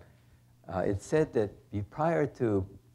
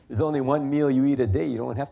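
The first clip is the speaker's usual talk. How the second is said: laughing; 6 semitones higher, 8 dB louder, faster than usual. Real, not acted. Amused joke, laugh heard.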